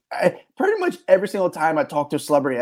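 ordinary delivery

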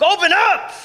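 high, loud yell at the car. Retold or imitated. At the frozen car door, yelling to open up. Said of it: "I'm yelling".

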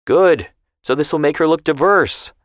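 sharp retort. A narrator's reading. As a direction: snap the answer right back. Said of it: "Grandpa shot back".

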